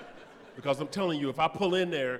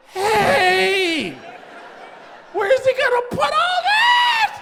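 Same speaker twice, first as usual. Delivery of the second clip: high loud loopy yell, stretched slowly; drugged shout; 8 dB louder, much slower than usual. Retold or imitated. Drugged patient yelling at the doctor about the hose, high and loud. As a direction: shout in woozy alarm with a laugh.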